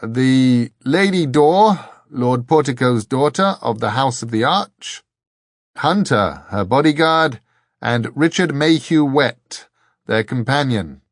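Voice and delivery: loud, formal announcement by a beaten monk; speaking through bruised lips. A narrator's reading. Beaten monk announces visitors to the voice, through bruised lips. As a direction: announce each newcomer with measured weight. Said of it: "through bruised lips".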